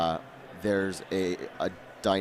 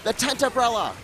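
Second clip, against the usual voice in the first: sudden excited call, high and bright; 11 semitones higher, 4 dB louder than usual. Real, not acted. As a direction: shout out the spotted play. Caster spots a play, exclaims.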